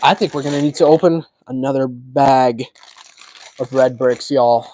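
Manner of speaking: ordinary talk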